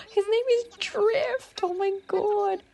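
high, drawn-out gushing squeal; delighted squeal. Real, not acted. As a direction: squeal with joy, stretching the words. Delighted by frog villager's name.

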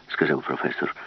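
ordinary delivery, nothing marked

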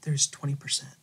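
soft and gentle, Jesus gently pointing out what is missing; tender, loving. Retold or imitated. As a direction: speak it softly with love. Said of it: "he looks at him lovingly in his eyes".

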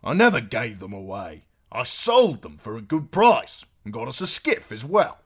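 loud, high, indignant protest; annoyed. A narrator's reading. Husband accused over the horses, loud annoyed protest.

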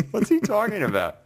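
mock baffled, high-pitched; baffled self. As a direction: play your own confusion, voice jumping up high. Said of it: "And I'm just like".

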